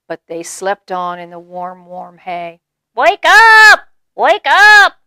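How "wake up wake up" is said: high, loud squeaky mouse cry; Mouse shouting awake. A narrator's reading. Mouse yelling at the sleeping animals, high and loud. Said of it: "Mouse repeated".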